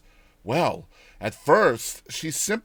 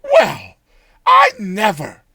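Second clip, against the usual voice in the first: put-on high, loud, dumbfounded old woman; stunned, then pleased; amazed old woman; 7 semitones higher, 12 dB louder, slower than usual. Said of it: "she said at last".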